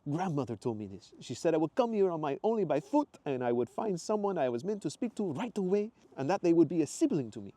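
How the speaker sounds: high, earnest Italian voice; Italian accent. Acted. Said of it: "his faded Italian accent".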